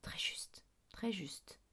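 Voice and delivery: whispered approval; murmur. A narrator's reading. Teacher approving the headmaster's words, in a whispered murmur. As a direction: whisper it very softly, almost without voice. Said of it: "Madame Windergast murmura".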